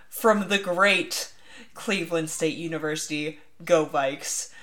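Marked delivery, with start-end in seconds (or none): none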